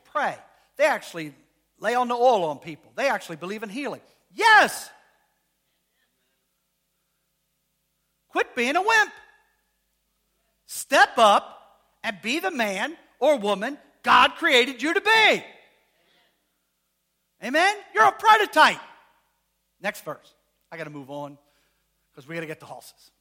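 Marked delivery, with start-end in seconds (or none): 4.3-9.1 high, loud rallying shout; shouted exhortation. Real, not acted. Preacher urging congregation, loud high shout. 10.7-18.8 rousing climax. Real, not acted. Preacher rallying congregation, loud rousing climax.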